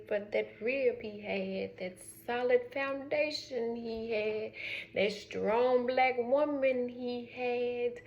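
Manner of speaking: each phrase drawn out slow; slow emphatic praise. Real, not acted. Speaker honors Coretta to viewers, slow emphatic tribute. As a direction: lean into every word with reverence. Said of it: "emphatic tribute".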